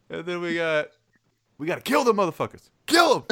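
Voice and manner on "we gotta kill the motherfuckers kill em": fast, rising to a full shout on kill 'em; rally the troops for battle; war cry. Retold or imitated. Quoting the stock exchange pep talk, fast escalating war shout. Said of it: "Like he's going to war".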